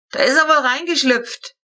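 put-on old roofer calling down to the family. A narrator's reading. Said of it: "rief Friedrich hinunter".

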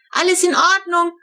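put-on high, loud child's yell; bellowed all-clear from the terrace; shouting. A narrator's reading. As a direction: yell it across the house. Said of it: "brüllte sie jedes Mal".

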